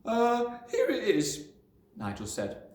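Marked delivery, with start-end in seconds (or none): 0.1-1.5 put-on high, eager child voice; a little boy about to perform; small boy. A narrator's reading.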